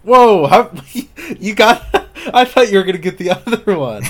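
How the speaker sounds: high, loud astonished laugh; surprised laughing. Real, not acted. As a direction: burst out in amazed laughter. Guest guesses right unexpectedly.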